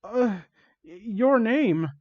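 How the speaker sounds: high, slow halting squeak. Acted. Amy timidly asks Sonic his name in a high squeak. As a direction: stammer it out small and high. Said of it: "Amy squeaked".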